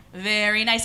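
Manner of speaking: ordinary delivery, nothing marked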